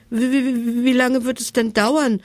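high stammered words, teeth chattering with cold. Acted. Naked monsters freezing on the oven bench, chattering. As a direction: stutter it out shivering. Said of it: "mit klappernden Zähnen".

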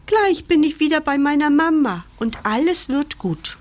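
high, bright-hearted promise to himself; bubbling over with hope; eager hope. Acted. Puppy sure he'll be with mama soon, excited.

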